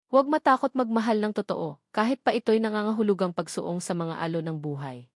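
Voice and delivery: usual speaking voice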